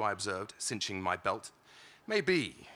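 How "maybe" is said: sighing. Acted. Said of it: "he sighed".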